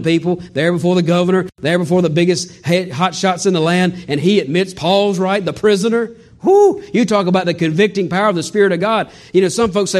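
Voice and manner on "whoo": high shouted whoo; ecstatic whoop. Real, not acted. Preacher celebrating the king's admission, loud high whoop. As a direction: let out a joyful whoop.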